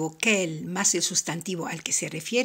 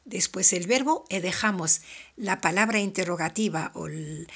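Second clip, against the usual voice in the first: usual speaking voice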